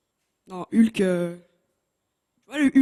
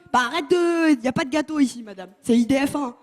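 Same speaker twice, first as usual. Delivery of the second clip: ordinary talk, nothing marked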